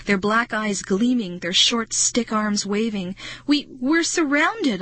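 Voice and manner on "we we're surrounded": high, slow, stammered alarm; crying out. A narrator's reading. Narrator to friend as creatures close in, crying out. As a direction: voice climbing as panic takes hold. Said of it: "I cried".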